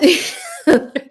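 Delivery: a burst of high laughter; sudden high laugh. Real, not acted. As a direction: laugh in delight. Laughing while retelling.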